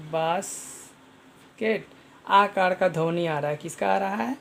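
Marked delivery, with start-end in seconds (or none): 0.1-1.9 basket stretched out very slowly; slow demo. Shown as a demonstration. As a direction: draw out basket so each sound is heard. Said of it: "क्या आ रहा है?". (धीरे-धीरे "basket")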